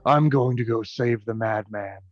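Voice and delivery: ordinary delivery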